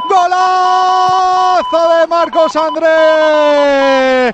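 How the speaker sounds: long roaring goal call; breakneck goal commentary. Real, not acted. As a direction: shout the goal with everything, stretching it. Gol de Valladolid, narrador cantando. (grito de gol eufórico y alargado)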